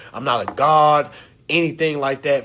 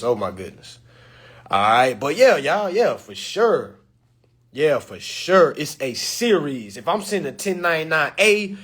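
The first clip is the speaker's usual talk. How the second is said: ordinary talk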